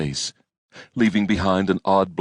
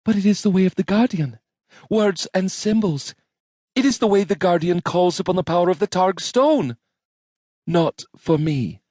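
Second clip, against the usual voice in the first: put-on young woman character voice; 14 semitones higher than usual. Rowan speaking in story.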